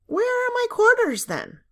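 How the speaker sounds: high, loud challenge, dropping away on then; rude defiance. A narrator's reading. Diane to Mrs Hanson, rudely demanding where her quarters are. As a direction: throw the question back at her.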